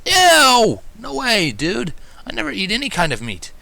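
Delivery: high, loud, grossed-out yell; a little dragon shrieking his refusal; disgusted denial. Acted. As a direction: recoil in horror at the idea. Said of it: "he denied instantly".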